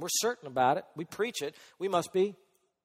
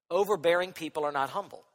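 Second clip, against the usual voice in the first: ordinary talk, nothing marked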